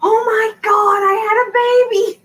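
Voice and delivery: shocked realization. Retold or imitated. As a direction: gasp as it hits you that you have a baby. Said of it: "And I'm like".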